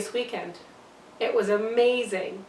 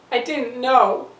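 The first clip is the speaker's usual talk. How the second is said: louder, emphatic denial; insistent protest; 7 dB louder, slower than usual. Acted. Mother defends drinking before she knew, loud and insistent. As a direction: push the words out in self-defence.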